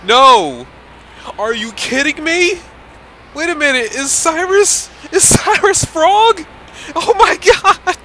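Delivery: freaking out. Real, not acted. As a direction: lose it over the twist. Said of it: "I'm freaking out".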